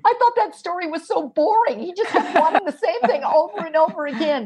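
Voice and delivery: a hint of an amused mother; mother recalling. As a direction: mom laughing at the boring story. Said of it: "The mother said".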